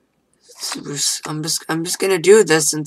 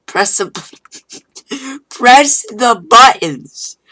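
mocking; 6 semitones higher, 6 dB louder, much slower than usual. Reading the game's tips mockingly.

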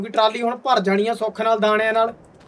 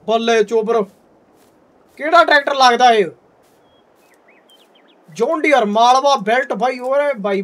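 hearty loud trucker banter; 5 dB louder than usual. Real, not acted. Admiring the new tractor.

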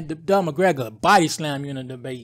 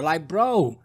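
ordinary delivery

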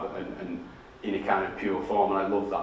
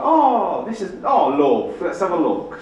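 high, loud cooing nana voice; doting nana; 9 semitones higher, 8 dB louder, slower than usual. Retold or imitated. Nana admiring the grandchild's book, doting and excited. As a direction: coo proudly as an imitated nan. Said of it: "she's my nana, so she's like".

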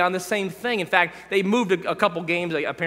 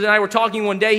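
normal talking voice

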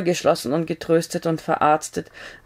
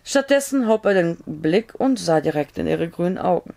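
plain reading voice

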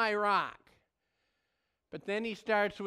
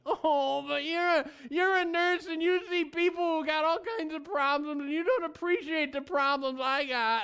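higher, mock self-pitying protest; whining; 6 semitones higher than usual. Husband whines to his nurse wife, mock whining. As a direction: whine back at the wife, hammed-up. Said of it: "And I'll whine".

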